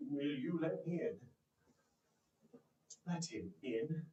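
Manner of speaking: ordinary delivery, nothing marked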